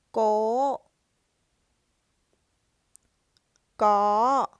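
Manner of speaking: "Ba", "ge", "gao" each loud, high, rising slightly at the end; Thai high tone. Shown as a demonstration. Teacher sounding out random syllables on the high tone. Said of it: "try to sound out random words".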